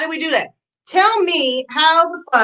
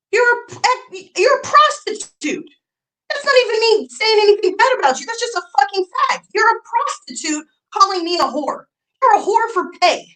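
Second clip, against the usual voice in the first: furious rant; 8 semitones higher than usual. Real, not acted. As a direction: spit it out in outrage.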